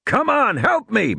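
high-pitched urging; urgent calling out. Acted. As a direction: call for help with urgency. Urging her amid raptor attack.

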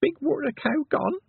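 put-on very high, tentative toddler question; meek little boy. A narrator's reading. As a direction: suggest it timidly in a tiny child's voice. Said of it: "he suggested meekly".

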